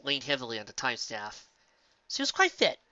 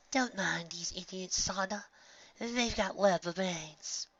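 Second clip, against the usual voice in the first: slow, high, soft whisper; whispery friend voice; 6 semitones higher, 4 dB quieter, slower than usual. A narrator's reading. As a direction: soothe her like a friend.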